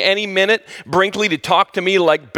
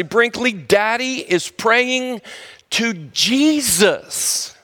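slow, higher, drawn-out Jesus; firm announcement; 4 semitones higher, much slower than usual. Shown as a demonstration. Owner telling his dog he is praying, slow and drawn out. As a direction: spell it out slowly to the dog. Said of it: "I test him again".

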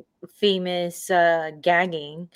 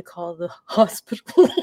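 laughing; 6 dB louder than usual. Real, not acted. Laughing while telling a funny story.

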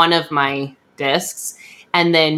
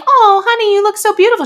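much higher, louder doting-mom voice; gushing mom; 4 dB louder, faster than usual. Retold or imitated. The mom she wishes she had praises her picture in a high, gushing voice. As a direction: gush over the photo like a proud mom. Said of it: "not like".

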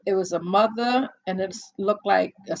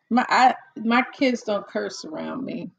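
ordinary talk

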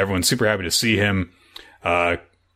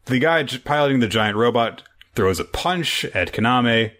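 normal talking voice

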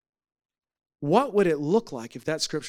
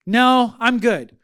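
loud, slow, higher-pitched brush-off; sister's blunt refusal; 5 semitones higher, 10 dB louder, much slower than usual. Retold or imitated. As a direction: imitate a sister turning it down, drawn out and emphatic. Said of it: "She goes".

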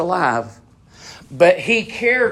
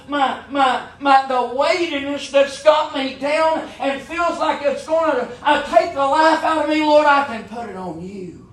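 pleading prayer, rising higher as it goes; impassioned prayer voiced from the pulpit; fervent prayer; 8 semitones higher than usual. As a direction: cry out to the Lord to take the burden. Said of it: "say, "Lord".

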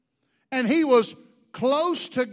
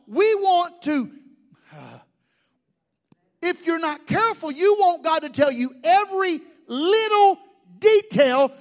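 louder, higher, driving the point home; preaching at full force; 4 semitones higher, 8 dB louder than usual. Acted. Pastor warning the church about blaming God, preaching loud. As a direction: hammer the point at the crowd.

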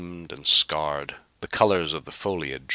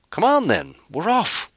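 high, loud, eager to leave; impatient; 7 dB louder than usual. Acted. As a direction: hurry them along. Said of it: "He was impatient to be gone".

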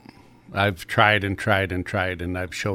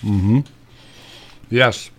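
mouth full. Real, not acted. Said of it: "Don't talk with your mouth full".

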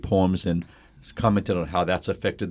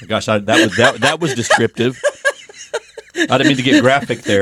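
louder, laughing embarrassed aside; 2 semitones higher, 10 dB louder than usual. Real, not acted. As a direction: laugh at your own words. Laughs at herself.

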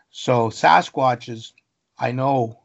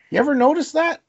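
asking people; 13 semitones higher, 3 dB louder, faster than usual. Retold or imitated. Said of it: "when I ask that of people".

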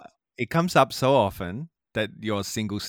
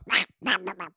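high quacking noises; Donald Duck; 6 semitones higher than usual. Shown as a demonstration. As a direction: squawk wordlessly like a cartoon duck. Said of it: "I can do a good Donald Duck".

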